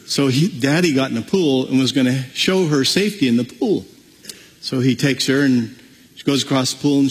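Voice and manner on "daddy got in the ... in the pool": ordinary talk, nothing marked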